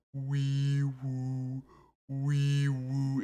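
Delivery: quiet, slightly high wee woo; gentle vocal siren; soft siren noise. Retold or imitated. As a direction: hum a calm little siren. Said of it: "similar to how SpongeBob did earlier".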